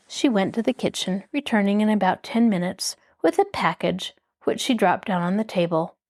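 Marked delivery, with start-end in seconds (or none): none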